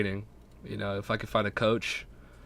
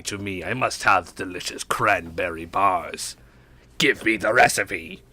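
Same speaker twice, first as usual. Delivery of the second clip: debutante voice; 4 semitones lower than usual. Acted. Jokingly begging a friend for cranberry bar recipe, debutante voice. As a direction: demand it like a drawling debutante. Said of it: "that debutante voice".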